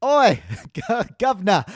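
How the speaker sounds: put-on an Australian accent sliding into Cockney; mock Cockney. Host's Australian impression drifting into a mock Cockney. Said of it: "I'm like turning into Sc".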